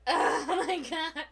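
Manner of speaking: loud, slow laughing gasp of panic; laugh-cry as the stream nearly crashes. Real, not acted. Streamer to viewers as the computer freezes, laughing panic.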